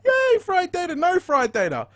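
mocking chat. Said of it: "They're like".

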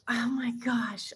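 breathless whispered oh my gosh; awed whisper. Real, not acted. Whispering in awe over a friend's recipe.

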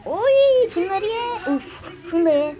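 high, loud gleeful squeal that fades; a giddy cry, then settling down; squealing excitement. Real, not acted. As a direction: coo it like you're feeding a pet. Playfully feeding in-game cells.

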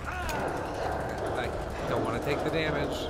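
high panicked yelps; scared horror game streamer. Real, not acted. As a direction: panic as you run. Panicking while fleeing enemies.

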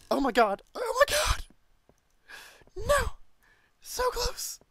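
very high, loud shrieking; real terrified squealing; panicked scream. Real, not acted. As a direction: scream it, voice leaping way up. Screaming at a near miss.

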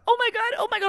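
put-on high-pitched kid alarm; imitating panicked son. Mom mimicking son learning it was mace, panicked. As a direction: repeat it in a child's high, frantic voice. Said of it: "And he's like".

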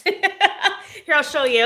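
laughing through the words. Real, not acted. Laughing while showing robe.

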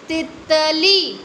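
तितली with short ति, long ली drawn out; teacher modelling तितली for children; stretched reading. Shown as a demonstration. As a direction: say तितली slowly, stretching the last ली. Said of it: "त में छोटी की मात्रा".

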